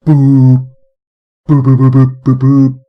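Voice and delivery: loud hooting boops; flute boops. Shown as a demonstration. Streamer imitating the wind instrument with loud boops. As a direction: blow each boop out like a flute. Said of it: "and we go".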